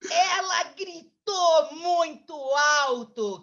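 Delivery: loud, slow, drawn-out storytelling; dramatic loud telling. Acted. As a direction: stretch it out loudly for the child. Builds up the girl's scream. (contando alto e arrastado)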